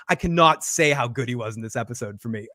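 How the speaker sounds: ordinary delivery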